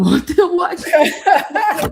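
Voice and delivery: high, loud, laughing through the quote; laughing retold quote. Wife quoting husband on Caillou, breaking into laughter. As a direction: crack up while quoting.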